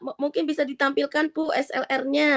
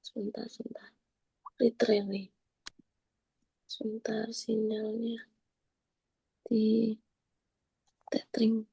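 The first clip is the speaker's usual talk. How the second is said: whispered to herself; whispering; 3 semitones lower, 9 dB quieter than usual. Real, not acted. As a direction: mutter it under your breath while busy. Fixing signal, muttering to herself.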